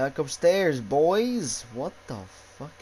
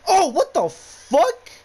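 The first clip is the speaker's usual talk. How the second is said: startled yell; 12 dB louder, slower than usual. Real, not acted. Scared by sudden event.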